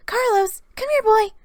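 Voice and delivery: Sarah calling her Glaceon from the hearth. A narrator's reading. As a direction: call the dog over across the room. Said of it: "Sarah called".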